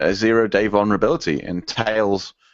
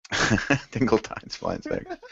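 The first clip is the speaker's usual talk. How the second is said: softer, slow mock title; soft amused echo; 7 dB quieter, slower than usual. Co-host echoing the joke title, soft and slow. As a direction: savor the joke quietly.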